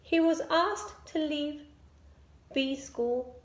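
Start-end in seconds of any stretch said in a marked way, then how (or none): none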